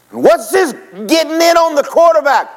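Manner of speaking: high, loud coach bark; yelling coach. Retold or imitated. Coach yells at the lineman, high and loud. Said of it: "turns to the lineman and goes".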